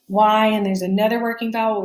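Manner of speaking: ordinary talk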